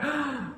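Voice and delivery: quick startled intake of breath; sharp gasp. A narrator's reading. Storyteller gasping as the children open the door. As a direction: gasp as if the door just opened. Door opens, old woman again.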